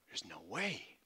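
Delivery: voiceless final "There's no way"; whispered. Retold or imitated. Simon's private verdict, whispered. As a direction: breathe out the last words. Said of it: "under his breath".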